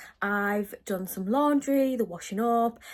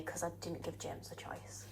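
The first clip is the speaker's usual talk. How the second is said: breathy unvoiced whisper; sneaky whisper about not giving him a choice; whispered aside; 2 semitones lower, 14 dB quieter than usual. Real, not acted. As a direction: whisper it so James barely hears.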